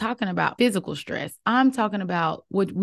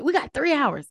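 higher-pitched mock alarm; panicking stress monkey; 6 semitones higher than usual. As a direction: play an inner voice suddenly panicking. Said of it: "that stress monkey in my head would be like".

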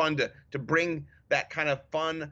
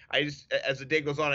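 usual speaking voice

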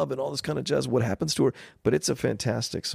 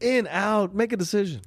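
higher-pitched, insistent push; impatient, raised-pitch mock heckle; impatient demand; 8 semitones higher than usual. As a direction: press them to decide now. Voicing the fed-up crowd's demand.

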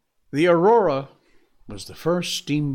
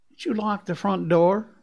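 sharp, higher, louder question; demanding; 7 semitones higher, 4 dB louder than usual. A narrator's reading. Matt pressing Scott about the door, demanding. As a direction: press him for an answer. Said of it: "Matt demanded".

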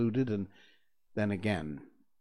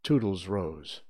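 usual speaking voice

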